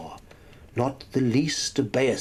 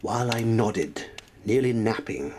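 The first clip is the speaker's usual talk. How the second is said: ordinary delivery, nothing marked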